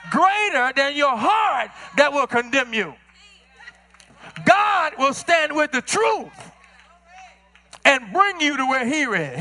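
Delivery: rising preacher's cadence, drawn out; full-force sermon delivery; preaching. Acted. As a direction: preach it to the congregation.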